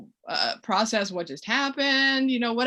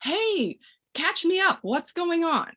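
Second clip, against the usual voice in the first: much higher, light and breezy to the grown-up; bright friendly interjection; 10 semitones higher, 3 dB louder, slower than usual. Shown as a demonstration. Parent cutting in on a grown-up, lightly higher and breezy. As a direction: cheerily pull the adult's attention away. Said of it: "I'm gonna take it to me and say".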